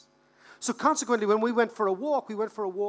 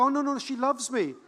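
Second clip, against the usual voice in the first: higher-pitched insistent objection; mock protest; 5 semitones higher, 4 dB louder than usual. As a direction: imitate a besotted friend protesting. Acting smitten friends' protest.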